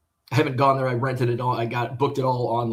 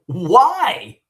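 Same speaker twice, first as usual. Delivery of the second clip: indignant why; 7 semitones higher, 8 dB louder than usual. Retold or imitated. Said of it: "I'm like".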